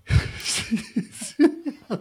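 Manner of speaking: breathy high-pitched giggle; giggling laugh. Real, not acted. Laughing at own flubbed intro.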